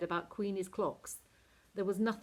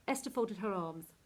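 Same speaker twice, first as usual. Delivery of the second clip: usual speaking voice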